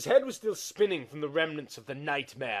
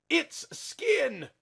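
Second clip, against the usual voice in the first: high, rising cry of horror; horrified cry; 6 dB louder than usual. A narrator's reading. Narrator recoils from the creature's skin, high and horrified. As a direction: gasp it out in revulsion.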